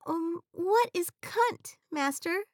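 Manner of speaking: high, slow innocent question; innocent genie. Acted. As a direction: ask naively, high and slow.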